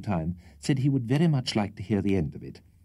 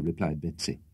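ordinary delivery

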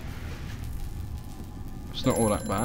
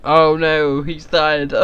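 mock dismay; 6 semitones higher, 7 dB louder, much slower than usual. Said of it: "It's a thing where you're like".

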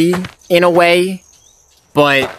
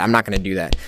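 normal talking voice

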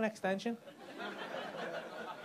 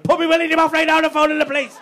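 much higher, very loud mock yell; husband shouting at his wife across the hotel, hammed-up yell; 10 semitones higher, 14 dB louder than usual. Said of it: "Started shouting that over the hotel".

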